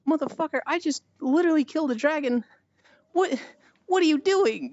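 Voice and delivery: high-pitched exasperated protest; mock indignation. Acted indignation at the guard.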